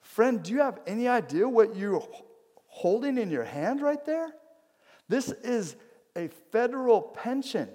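high-pitched incredulous onlooker; storyteller's astonished voice, high and emphatic; acted astonishment. Onlookers astonished by the veteran's paper.

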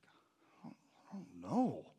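a quiet, half-swallowed "I don't know"; scared mumble. Retold or imitated. Frightened boy answers the principal quietly. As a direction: shrink away, barely getting it out.

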